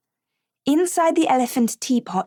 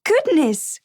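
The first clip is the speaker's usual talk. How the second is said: a sudden higher exclamation; surprised cry; 6 semitones higher than usual. Acted. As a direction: gasp it out as a sudden realisation. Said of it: "cried Marjorie".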